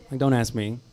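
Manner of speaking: ordinary delivery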